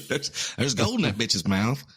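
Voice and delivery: put-on slow cackly old timer; gold-rush geezer voice; old prospector voice. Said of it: "old prospector voice".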